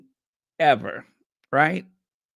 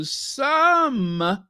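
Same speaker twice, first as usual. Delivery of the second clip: "some" drawn out and pushed higher; stress on SOME; 11 semitones higher than usual. Real, not acted. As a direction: lean hard on "some", stretching it. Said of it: "let me stress it".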